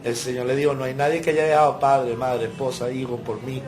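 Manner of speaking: loud, high, fervent preaching; rousing pulpit cry, voice pushed to the top; preaching at full force. Acted. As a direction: lift the congregation, thundering the point home. Preaching climax on Peter.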